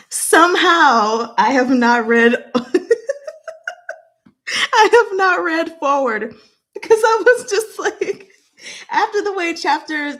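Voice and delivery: high, loud, breaking into laughs; real giggly laughter. Real, not acted. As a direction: struggle to talk through the giggles. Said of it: "I am so giggly right now".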